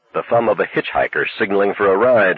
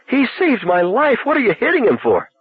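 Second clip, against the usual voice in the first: breathless protest. Acted.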